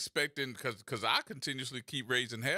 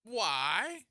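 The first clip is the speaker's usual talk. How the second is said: mock astonishment; 9 semitones higher, 3 dB louder than usual. Exaggerated high question.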